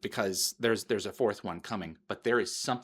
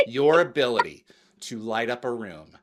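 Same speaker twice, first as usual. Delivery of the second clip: normal talking voice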